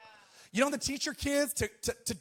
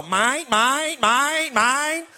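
louder squawking mine, mine over and over; seagulls from Nemo; 2 semitones higher, 5 dB louder, much slower than usual. Retold or imitated. Pastor imitating the Nemo seagulls for a laugh, squawking. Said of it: "like the seagulls from Nemo".

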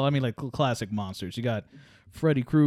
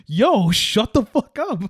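loud, slow, drawn-out retort; mock yell; 10 semitones higher, 8 dB louder, slower than usual. Telling his friend off in the story, loud and slow. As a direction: bark it back at the friend. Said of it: "And I'm like".

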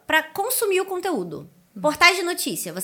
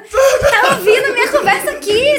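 higher and louder, laughing; joking, high-pitched and loud; excited laughing; 10 semitones higher, 10 dB louder than usual. Real, not acted. Joking Google is listening.

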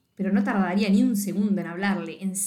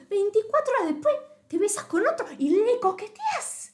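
high, indignant recap of the betrayal; scandalized gossip, pitched high; outraged disbelief; 11 semitones higher than usual. Retold or imitated. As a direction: spit out the scandal, voice way up.

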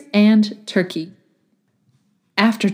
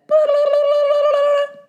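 very high sing-song babble; a silly eyeball trilling nonsense; talking eyeball. A narrator's reading. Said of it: "And the eyeball said".

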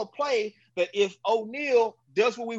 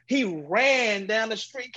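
ordinary talk, nothing marked

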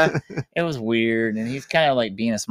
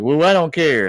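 high, louder curt brush-off; dismissive retort; 8 semitones higher, 8 dB louder than usual. Retold or imitated. Howard brushing off Beverly, curt and dismissive. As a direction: toss it off like a sulky teen.

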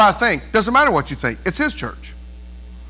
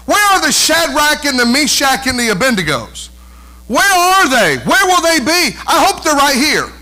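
normal talking voice

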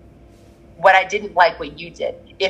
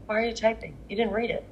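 exasperated hush; 2 semitones higher, 13 dB quieter than usual. Retold or imitated. Retold exasperation at quick reply.

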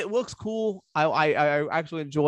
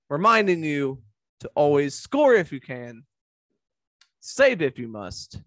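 slow, deliberate outro line; sign-off catchphrase; 3 dB louder, slower than usual. Acted. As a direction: lean into the show's motto.

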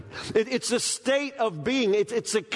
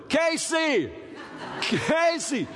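calling out; 8 semitones higher, much slower than usual. Retold or imitated. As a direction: call her name from the bedroom. Husband calling from dark bedroom.

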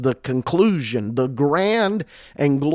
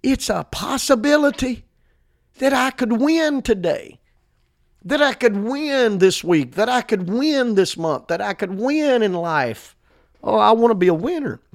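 high-pitched, exultant preaching; shouting the victory; 8 semitones higher than usual. Acted. Preacher rousing believers to claim victory, exultant. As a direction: ring out each "win" as a triumph. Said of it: "shout the victory".

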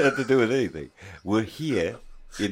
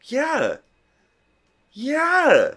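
very high, drawn-out hammed-up yeah; mock Austrian yeah; 6 dB louder than usual. Still playing the Austrian, very high mock yeah. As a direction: keep the silly Austrian voice going.

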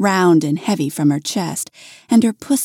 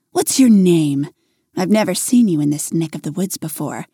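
ordinary delivery

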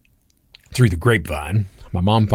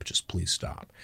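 whispered, much softer, fading out; whispered plea; 3 semitones lower, 13 dB quieter, slower than usual. Retold or imitated. Dad begging mom to stop, whispered and fading. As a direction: breathe it, almost giving up. Dad pleading during parents' fight.

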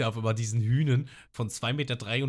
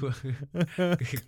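ordinary talk

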